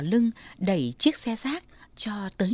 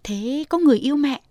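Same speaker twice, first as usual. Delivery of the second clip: higher and louder playful question; teasing daughter; 6 semitones higher, 6 dB louder than usual. Acted. Daughter teasing her mother about a suitor, higher, louder playful question.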